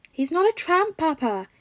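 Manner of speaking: put-on high, loud little boy's protest; child crying out. A narrator's reading. Three-year-old boy protesting to his father, high and loud. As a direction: shout it up high like a small child. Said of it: "Izuku cries out".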